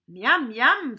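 loud, swooping yum-yum; greedy relish. Acted. César eyes the flies round the crocodile, greedily relishing. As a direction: savour it with hungry delight.

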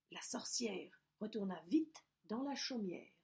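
plain reading voice

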